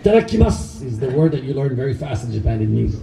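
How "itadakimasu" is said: Japanese mealtime call. Retold or imitated. Ritual mealtime call before eating.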